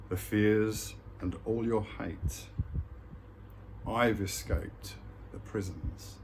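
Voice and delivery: slow, measured poem reading with long pauses; poem recitation. Acted. Speaker sharing a poem with viewers, slow solemn recitation. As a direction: declaim each short line, then let it hang.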